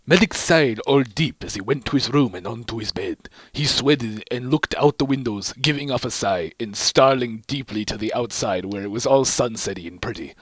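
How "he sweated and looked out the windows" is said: ordinary delivery, nothing marked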